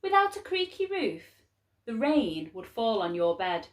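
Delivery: ordinary delivery, nothing marked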